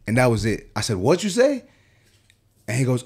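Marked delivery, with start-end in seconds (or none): 1.0-1.6 high-pitched, offended comeback; sharp challenge. Retold or imitated. Barber confronts client who swore at him, sharp challenge.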